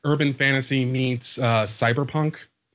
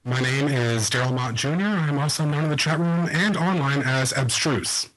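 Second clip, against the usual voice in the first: blown-out mic sound; clipping distorted mic. Real, not acted. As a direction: voice clipping through a hot mic. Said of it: "You sound very blown out".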